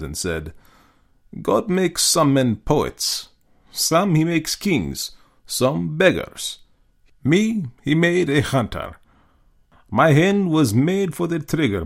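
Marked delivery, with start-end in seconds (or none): 1.4-11.8 slow, grand pronouncements; a hint of a stately aristocrat, slow; lightly voiced Russian general. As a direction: savour each phrase like a creed. General Zaroff philosophizes.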